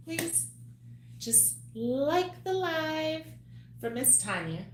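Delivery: very high, slow begging singsong; high pleading voice. Acted. To her live viewers, pleading in a very high, slow voice.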